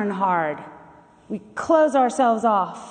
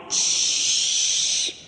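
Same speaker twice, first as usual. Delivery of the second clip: unvoiced sizzle like frying bacon; sizzling noise; 3 dB quieter than usual. Shown as a demonstration. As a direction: hiss like bacon in a pan. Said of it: "make a loud sizzling noise, like bacon frying".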